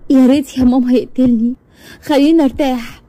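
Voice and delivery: high, tearful hopelessness; girl sobbing to her mother, high and broken; crying despair. Acted. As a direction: say it through tears, wanting to give up. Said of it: "فضلت تعيط جامد".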